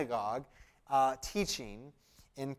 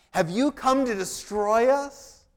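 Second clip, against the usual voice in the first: loud, slow scripture reading of the demon's cry; demon's words read out; 6 semitones higher, 7 dB louder, slower than usual. Acted. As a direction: declaim the demon's challenge slowly and loudly. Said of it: "This is what the demon says".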